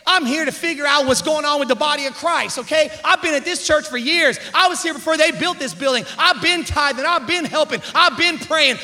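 mock offended church veteran; acted self-importance. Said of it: "to Jesus like this".

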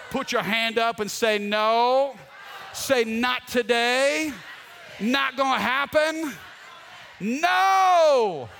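high, loud, drawn-out refusals; rallying preacher at full shout; defiant shouting. Shown as a demonstration. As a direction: lead a crowd in shouting it down, building to the last word. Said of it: "Come on, shout no".

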